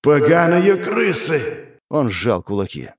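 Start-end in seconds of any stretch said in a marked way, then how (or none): 0.0-1.8 higher, very slow monstrous roar; demigod's rage. A narrator's reading. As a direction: spit the words like a furious demon. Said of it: "Голос полубога походил ... эхо сотни голосов".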